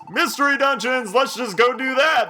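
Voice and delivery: jokey enthusiastic shout; excited outburst. Real, not acted. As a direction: blurt the idea out eagerly. Sudden eager outburst.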